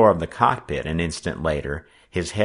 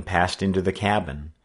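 plain reading voice

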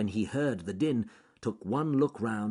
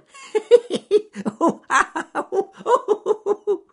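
shrill squealing laughter; high giddy laugh; 12 dB louder than usual. A narrator's reading. Alec laughing at his own joke, high giddy laugh. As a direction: laugh yourself silly, very high. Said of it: "laughed himself silly".

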